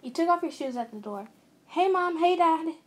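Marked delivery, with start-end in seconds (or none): 1.7-2.8 louder, higher cheerful hello to parents; raised-voice greeting; calling out a greeting. A narrator's reading. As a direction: call out to the folks as you walk in. Greets parents on arriving home.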